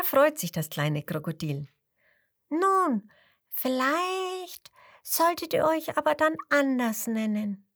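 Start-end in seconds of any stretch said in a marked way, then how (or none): 2.5-7.7 much higher small crocodile voice; a tiny, bright-eyed croc advising; little crocodile. A narrator's reading. (kleines Krokodil, viel höhere Stimme)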